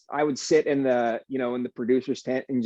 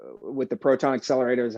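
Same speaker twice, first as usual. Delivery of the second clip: ordinary talk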